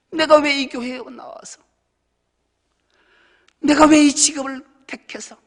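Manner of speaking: high, loud self-reproach; self-blaming lament. Retold or imitated. Person blaming themselves for past choices, high and loud. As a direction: berate yourself, voice climbing. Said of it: "이렇게 자책".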